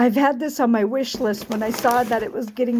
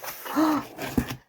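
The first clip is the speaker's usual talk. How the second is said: gasp; 5 semitones higher, 6 dB quieter than usual. Real, not acted. Unboxing a surprise gift.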